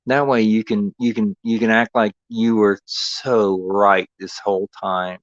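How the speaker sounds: ordinary talk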